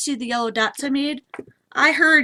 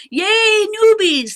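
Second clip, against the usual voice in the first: cheer; 8 semitones higher, 5 dB louder, slower than usual. Real, not acted. Welcoming new viewers.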